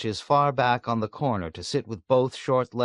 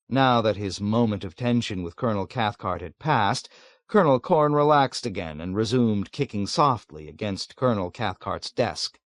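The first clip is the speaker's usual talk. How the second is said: plain reading voice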